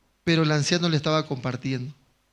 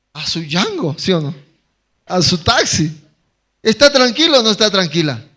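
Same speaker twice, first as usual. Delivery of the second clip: predicación con fuerza; 7 dB louder than usual. Acted. Preaching about waiting on Jehová.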